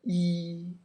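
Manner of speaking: 一 said level and high; 一 kept even, no rise; correct Chinese tone. Shown as a demonstration. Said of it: "in Chinese is different word".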